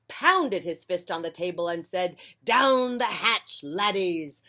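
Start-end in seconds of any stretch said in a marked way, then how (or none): 2.4-4.4 loud, slow, higher hearty toast; pirate toast. Acted. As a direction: boom it out and bang the table. Captain toasting at table.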